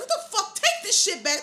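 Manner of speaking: high-pitched retold outburst through laughter; mad dad. Storyteller imitates dad cussing out the address seller, mock angry. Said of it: "My dad was so mad".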